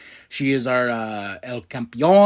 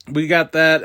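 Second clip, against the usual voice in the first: ordinary talk, nothing marked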